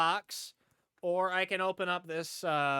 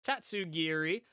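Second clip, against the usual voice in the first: normal talking voice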